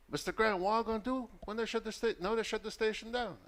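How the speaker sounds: high-pitched impression of a country vendor; mock high voice of an anxious caller; rural vendor woman. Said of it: "the lady sounded like, uh, she was a vendor".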